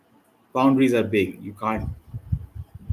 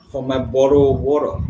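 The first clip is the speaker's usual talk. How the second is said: mock English accent, louder; 5 dB louder than usual. Viewers hear a joke about a bottle of water, mock English accent. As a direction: put on an English accent, playfully. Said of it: "not making fun of your accent".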